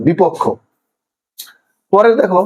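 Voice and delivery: ordinary talk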